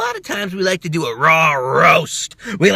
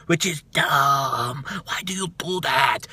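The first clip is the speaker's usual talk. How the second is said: mock whimpering complaint; 6 semitones lower, 8 dB quieter than usual. Mock lament.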